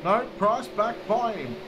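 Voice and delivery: energetic motorsport race call; live race play-by-play. Real, not acted. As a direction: call the race live, surging at passes and contact.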